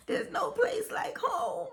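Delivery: high, slow wishful plea; Dorothy's line. Acted. Woman homesick for her hometown, wishful Dorothy recital. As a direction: recite it high and drawn out, like a movie heroine. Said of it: "I feel like Dorothy in The Wizard of Oz".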